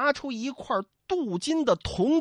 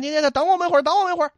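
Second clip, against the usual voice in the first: high, raised, stammering call; flustered calling out; 6 semitones higher, 5 dB louder, much faster than usual. Acted. Two comrades chase Li Kui, flustered high call. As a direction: call after him in a fluster.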